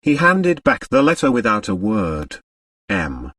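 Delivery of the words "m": ordinary delivery, nothing marked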